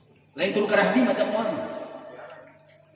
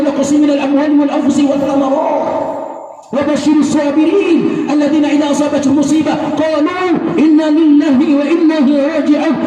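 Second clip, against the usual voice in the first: loud chanted recitation; ringing Quranic chant to the mourners; 4 semitones higher, 7 dB louder than usual. Acted. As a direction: intone the verses at full voice like a preacher. Preacher recites Quran verses aloud.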